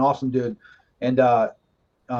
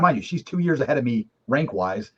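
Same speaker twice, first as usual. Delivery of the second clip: usual speaking voice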